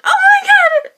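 shrieking high and loud with joy; excited scream. Retold or imitated. Vlogger reliving meeting Gabby, high joyful scream. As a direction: squeal it like meeting your idol. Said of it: "I'm like".

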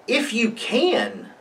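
high, drawn-out echo in disbelief; Jesus, a little frustrated. A narrator's reading. Jesus answering the boy's father, a little frustrated. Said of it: "Jesus is getting a little frustrated".

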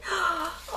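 high gasp of delight; excited gasp. Real, not acted. Crafter opening the watercolor crayons, excited gasp. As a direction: catch your breath in thrilled surprise. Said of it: "totally gets me excited".

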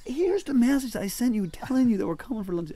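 high, loud mock-exasperated protest; exasperated retort. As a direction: throw the proof at him, hammed-up indignation. Retelling an unready host, exasperated.

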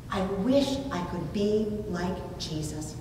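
usual speaking voice

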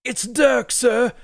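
high, loud voice of a nervous northern soldier; rough soldier Dirk. Acted. Dirk reports to his commander Morgrig, high anxious soldier voice. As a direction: answer your commander, anxious and eager.

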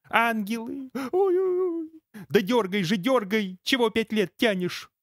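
high anguished wailing; high tearful howling; pained high wail. Acted. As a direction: yell at him to pull, high and pained.

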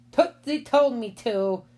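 put-on slow, high childish excuse; drawn-out, innocent kid voice; little boy voice. A narrator's reading. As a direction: blame the baby like a small child. Little boy makes his excuse.

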